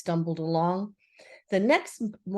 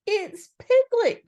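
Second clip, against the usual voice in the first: eager, slow, higher call; eager cry; 13 semitones higher, 6 dB louder, slower than usual. A narrator's reading. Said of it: "cried Pooh eagerly".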